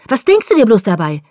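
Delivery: high, loud accusing questions; heated, high-pitched outburst; furious yelling. A narrator's reading. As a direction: hurl the questions at him in rage. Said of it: "schrie er".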